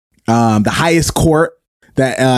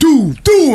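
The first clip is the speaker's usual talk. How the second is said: mock doot; 11 semitones higher than usual. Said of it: "So it was like".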